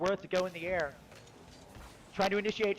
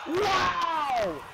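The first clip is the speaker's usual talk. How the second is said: loud, high, drawn-out exclamation; amazed shout; 9 semitones higher, 5 dB louder than usual. Real, not acted. Caster blown away by a goal, amazed shout. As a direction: belt out your amazement.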